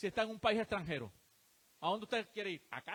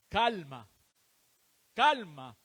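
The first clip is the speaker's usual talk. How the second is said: louder, insistent Calma; emphatic calm; 3 semitones lower, 10 dB louder, much slower than usual. Real, not acted. Preacher urging the troubled congregation, firm and loud. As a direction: press Calma on them firmly.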